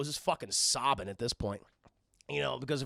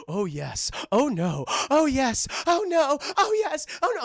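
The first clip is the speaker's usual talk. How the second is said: breathy alternating moans, climbing higher and louder; mock lovemaking; 10 semitones higher, 7 dB louder, slower than usual. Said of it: "clearly having a sexual relationship".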